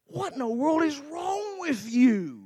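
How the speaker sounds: high, drawn-out exasperated outburst; mad. Retold or imitated. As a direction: imitate a friend losing patience, stretching the words. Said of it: "finally he gets mad at me".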